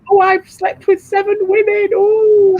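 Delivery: high, mocking childish brag; sneering imitation with a drawn-out ooh; boastful little kid. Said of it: "fucking kids in the chat like".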